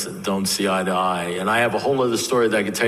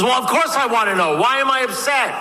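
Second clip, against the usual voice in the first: high-pitched, worked-up yell of impatience; acted frantic exasperation, pitched way up; exasperated demand; 3 dB louder than usual. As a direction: burst out, desperate to be told.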